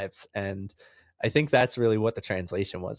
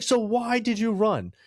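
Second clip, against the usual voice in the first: exasperated cop; 12 semitones higher than usual. Retold or imitated. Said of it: "He's like".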